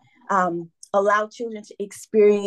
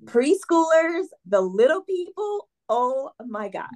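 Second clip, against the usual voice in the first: real excitement; 6 semitones higher than usual. Real, not acted. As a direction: gush about who you love teaching. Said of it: "hear the excitement in my voice".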